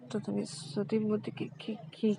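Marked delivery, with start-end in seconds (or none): none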